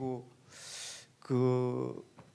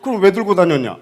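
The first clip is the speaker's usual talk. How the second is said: loud, high, indignant yell; angry shout; 7 semitones higher, 15 dB louder than usual. Retold or imitated. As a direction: hurl the question back in anger. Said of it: "막 이 사람이 막 화가 나는 거야". (화가 나서 소리치듯)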